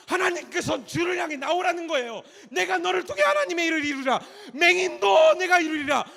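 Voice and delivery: loud, high, building proclamation; climactic preaching. Acted. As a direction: rise to a thundering finish. Climactic preaching of God's promise. (절정으로 치닫는 외침 설교)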